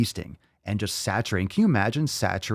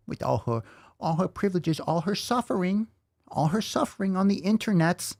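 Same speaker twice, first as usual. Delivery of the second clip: higher, mock-pitying sing-song; 7 semitones higher than usual. Vlogger mocking the TikTok woman's suffering, higher and sneering. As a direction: mimic her self-pity with a sneer.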